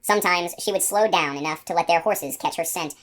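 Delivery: ordinary delivery, nothing marked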